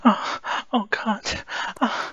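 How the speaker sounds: high, breathy oh God; moaned cries of pleasure; high moaning. Acted. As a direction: gasp with rising pleasure. Character nearing climax.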